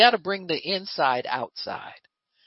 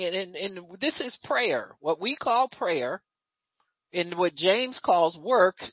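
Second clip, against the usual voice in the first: ordinary talk, nothing marked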